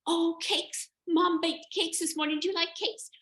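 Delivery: put-on very high, loud little-kid voice; squeaky goat kid; eager kids. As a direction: chirp nervously eager like a child. Kids offer cakes.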